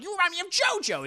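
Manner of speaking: high, fast mocking line; sneering vampire. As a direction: toss it off with a sneer. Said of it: "he's like".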